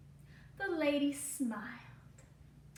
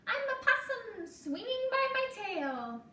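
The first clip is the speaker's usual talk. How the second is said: put-on playful little girl; 12 semitones higher, 5 dB louder than usual. A narrator's reading. Emmy playing on the tree.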